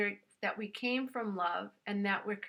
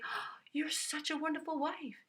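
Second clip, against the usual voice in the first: a light hint of a pleased husband's voice, a little higher; husband's praise; 6 semitones higher, 3 dB quieter than usual. Husband coming home praising his wife, lightly voiced. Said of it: "want your husband to come home and say".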